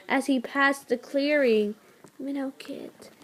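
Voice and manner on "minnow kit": much softer, wheezing near-whisper; a dying queen's wheezed echo; wheezy echo. Acted. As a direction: repeat it on a failing breath. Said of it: "echoed with a wheeze".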